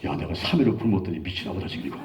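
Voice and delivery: low, softened muttering to himself; retold inner thought. Preacher recalling his thought during fasting prayer, inner muttering. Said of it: "내가 속으로 그랬어".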